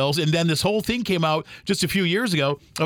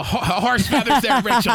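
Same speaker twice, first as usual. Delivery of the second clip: laughing; 5 semitones higher, 4 dB louder than usual. Real, not acted. Laughing echo of her slang.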